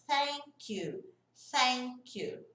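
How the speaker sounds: "thank" with the tongue between the teeth, air blown out; careful "thank you", voiceless TH; TH demo. Shown as a demonstration. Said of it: "mesma coisa". ("thank" com a língua entre os dentes, soprando)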